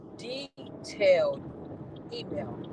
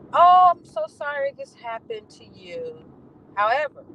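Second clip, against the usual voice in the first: higher, louder, slow fake-sorry "Oh, I'm so sorry"; syrupy insincere sorry, drawn out and loud; mock sweet apology; 9 semitones higher, 6 dB louder, slower than usual. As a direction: sneer the apology in a sugary mock voice. Quoting the supervisor's email.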